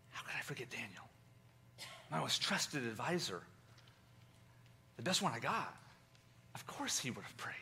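the king quietly realising his loss; hushed grief. Retold or imitated. King grieves over Daniel's fate.

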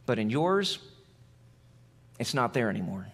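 tender, quiet confession. Real, not acted. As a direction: drop to an intimate near-whisper. Pastor's intimate low appeal.

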